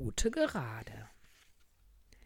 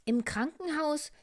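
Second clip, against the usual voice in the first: high, loud nurse voice; nurse's announcement; 9 dB louder than usual. Acted. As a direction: announce it brightly, high above your storytelling. Nurse Luzi announces rules. (hohe, laute Krankenschwesterstimme)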